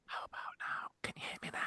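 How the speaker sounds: breathy, voiceless whisper, barely audible; mock whisper. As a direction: tease him by whispering back after he asks for quiet. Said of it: "Can you just lower your voice a little bit?".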